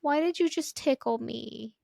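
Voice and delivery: little daughter. Retold or imitated. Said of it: "And she's like".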